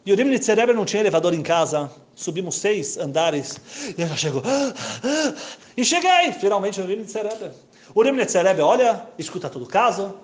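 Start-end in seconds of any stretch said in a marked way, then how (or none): 4.5-5.5 high breathless gasp after the stairs; winded from climbing six floors; out of breath gasp. Retold or imitated.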